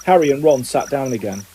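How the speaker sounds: plain reading voice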